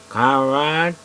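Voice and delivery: loud, slurred, questioning call. Retold or imitated. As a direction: call the name out like a drunk stranger. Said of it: "he's slurring his words, mumbling a bit".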